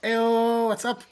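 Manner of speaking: high, loud drawn-out "Eyo"; playful greeting call. Real, not acted. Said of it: "I love that little ew".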